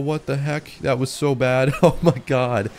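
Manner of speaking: ordinary talk, nothing marked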